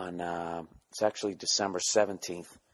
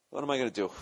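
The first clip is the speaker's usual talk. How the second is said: ordinary talk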